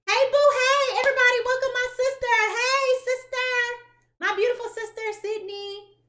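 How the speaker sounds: high, loud, fast party welcome; excited greeting. Pretend. Walking into the room greeting her sister with loud joy. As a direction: greet everyone like a star entering.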